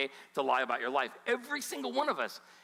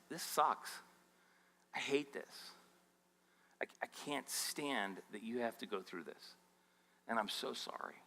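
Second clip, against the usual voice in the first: hushed, lower words of sympathy; sincere condolence; 5 semitones lower, 9 dB quieter than usual. Acted. Pastor to the bereaved in a funeral line, hushed condolence. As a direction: soften and drop the voice, as if to a mourner.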